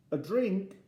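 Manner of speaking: put-on high, sing-song offer to a child; coaxing parent. Dad offering Eddie picnic food, high coaxing. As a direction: offer it brightly, pitched well up.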